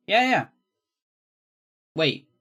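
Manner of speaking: ordinary talk, nothing marked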